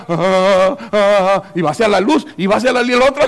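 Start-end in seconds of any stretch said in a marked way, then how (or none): 0.1-1.4 drawn-out entranced "ah" sounds; dazed moan. Acted. Insect helplessly flying toward the lamp, entranced moan. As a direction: moan like a bug pulled to the light.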